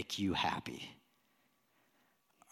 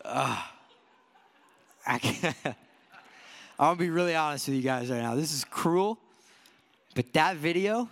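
ordinary talk, nothing marked

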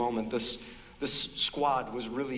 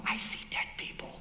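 hushed, voiceless movie-line whisper; spooky whispered quote; 5 dB quieter than usual. Retold or imitated. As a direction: breathe it like the creepy kid in the film. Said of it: "this really famous line".

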